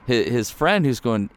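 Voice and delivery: usual speaking voice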